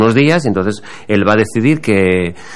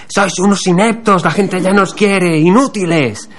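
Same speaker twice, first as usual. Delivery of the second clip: imitación aguda de una bronca a gritos; gritos de reproche; 9 semitones higher than usual. Retold or imitated. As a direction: imita una nota de voz indignada, en tono muy agudo. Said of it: "mandó una nota de audio gritando". (regañina chillona e hiperbólica, imitada)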